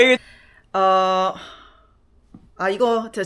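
usual speaking voice

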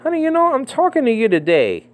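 high, silly mock sweetness; mock talk to wife. Mocking speech to his wife, exaggerated. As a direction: show how absurd it sounds. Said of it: "I don't say to my wife".

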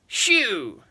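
a high, loud witch's shriek. A narrator's reading. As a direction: shoo them off with a shriek. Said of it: "she shrieked".

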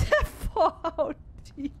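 giggling the name out; laughing. Real, not acted. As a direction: laugh through the words.

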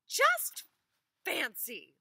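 high, loud gasp of wonder; amazed wife. Acted. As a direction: exclaim it, astonished.

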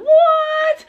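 high, loud astonished cry; shocked what. Retold or imitated. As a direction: gasp it out in disbelief. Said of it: "My mind was blown".

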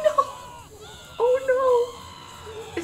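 high, wailing cry of dismay; alarmed cry. Real, not acted. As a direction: cry out in panic, pitch way up. Another death in the game.

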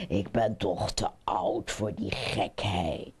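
put-on deep old-frog voice. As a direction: wave it away in a very low voice. Old frog dismisses such foolishness. (diepe stem van de oude kikker)

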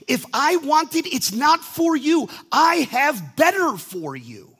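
high, loud and slow, firm assurance; God's reply. Retold or imitated. God answering the reluctant believer, loud and slow. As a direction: lay down the answer with authority. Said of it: "And he's like".

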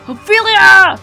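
desperate loud cry of a name; anguished shout. Acted. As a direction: scream her name as you die. Dying giant calls her.

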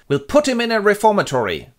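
high, loud, decisive father voice; hammed-up strict dad laying down the law; stern father. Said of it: "and Mister Gabor goes".

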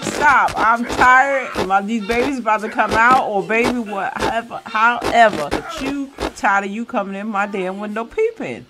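loud, exasperated mock rant; fed-up woman in labor. Voicing a laboring Sim telling off a peeper, loud and fed up. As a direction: snap it loudly, fed up with him.

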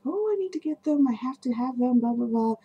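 high, breathless want-it-all gush; eager gushing. Retold or imitated. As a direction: gush like a greedy collector. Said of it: "at first I was like".

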